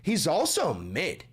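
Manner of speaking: normal talking voice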